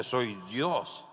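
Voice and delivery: low, imposing voice; God. Acted. God identifying himself to the hanging man, imposing. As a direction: answer with deep, measured authority. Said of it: "Dios con su voz imponente".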